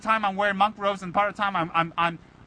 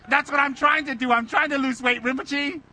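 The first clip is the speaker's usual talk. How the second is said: higher, louder gleeful protest; delighted reply; 7 semitones higher, 4 dB louder than usual. Retold or imitated. Answering gurus who say he's skinny, higher and gleeful. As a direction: beam back that losing weight is the point. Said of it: "And I'm like".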